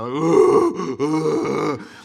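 loud heaving gasping wail; Chewbacca heave-sob. Retold or imitated. Showing how he cried at his son's video, loud heaving sob. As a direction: imitate an ugly snot cry like a Wookiee. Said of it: "like Chewbacca".

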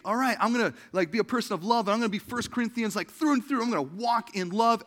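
high, loud, gung-ho declaration; overeager resolve. Acted. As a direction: play the perfectionist pumping themselves up. Acts out an overeager perfectionist.

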